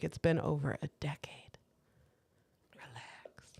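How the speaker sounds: unvoiced mocking whisper to the ex. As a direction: breathe it out as a dismissive aside. Telling off an ex, whispered.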